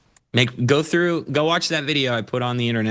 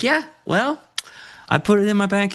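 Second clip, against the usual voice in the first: mock dismissive; 10 semitones higher than usual. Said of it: "it's like".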